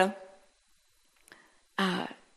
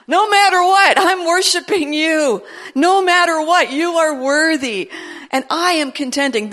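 fervent declaration; 5 semitones higher, 5 dB louder than usual. Acted. Declaring worship prayer.

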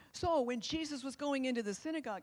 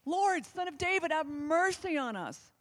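a more desperate, higher call; crying out louder; 6 semitones higher, 5 dB louder than usual. A narrator's reading. Blind men crying out over the crowd, more desperate. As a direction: plead harder than the first time. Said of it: "they cried out all the more".

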